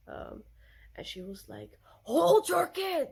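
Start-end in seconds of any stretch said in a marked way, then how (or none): 2.0-3.1 loud, high, drawn-out scolding shout; mock furious old lady shouting; imitated angry yell. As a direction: yell it like an outraged stranger at a parent. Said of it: "she was so angry about it".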